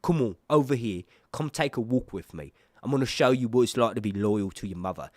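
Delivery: put-on British accent, casual and coaxing; British-accented goading, phrase by phrase; British Daemon impression. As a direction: play a swaggering British tough inviting someone for a walk. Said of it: "when I do a British accent".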